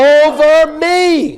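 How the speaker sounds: high, very slow angry cry; modelled outrage. Shown as a demonstration. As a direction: stretch both words in protest.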